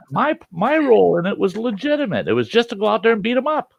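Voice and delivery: high, pleading self-defense; squirming high-pitched justification; panicked excuse. Acted. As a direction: argue your innocence, voice way up. Argues his case to cop.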